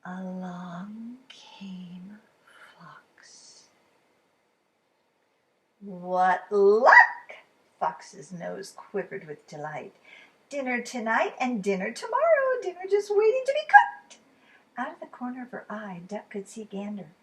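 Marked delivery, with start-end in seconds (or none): 0.0-3.7 slow, hushed whisper; suspenseful whisper. A narrator's reading. Narrator revealing Fox to listening kids, slow whisper. 5.8-14.2 louder, sly villain fox voice; gloating fox. Acted. Fox finds Duck and Gander for dinner, gloating.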